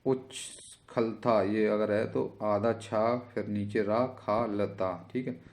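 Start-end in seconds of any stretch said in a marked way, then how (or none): 0.0-1.4 उच्छलता drawn out with a break after उच्; very slow sounding-out. Shown as a demonstration. Teacher sounds out the spelling. (उच्छलता को बहुत धीरे तोड़कर)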